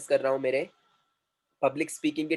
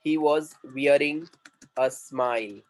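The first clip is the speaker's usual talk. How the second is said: measured teaching delivery, spaced out; slow dictation; much slower than usual. Shown as a demonstration. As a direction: present the phrase slowly to learners. Teacher presents a new English phrase. (धीरे-धीरे, समझाते हुए)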